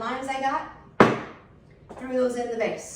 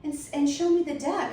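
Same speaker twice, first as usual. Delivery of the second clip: higher syrupy mock concern; fake sweet neighbour; 7 semitones higher than usual. Neighbour coaxing the wife off to bed, high sugary mock concern. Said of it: "So she's like".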